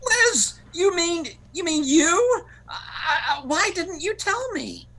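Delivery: high-pitched, stammering amazement; astonished. Acted. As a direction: blurt it out, stunned by the baby news. Friend wrongly thinks she is pregnant.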